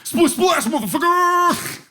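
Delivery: high-pitched, drawn-out mock DJ holler; hype DJ yell. Pool DJ to the swimmers, high hyped-up yell. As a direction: blare it out as a hammed-up catchphrase.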